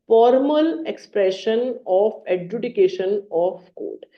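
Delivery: reads the definition word by word, very slow; slow dictation. Shown as a demonstration. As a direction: spell it out slowly for notes. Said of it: "तो degree देखिए क्या होता है". (बहुत धीमे, शब्द-शब्द लिखवाते हुए)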